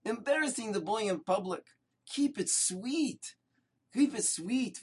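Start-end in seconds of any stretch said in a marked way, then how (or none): 2.1-3.4 slow drawn-out refrain. Acted. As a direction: linger on keep it sweet like a lesson. Repeated refrain after the story.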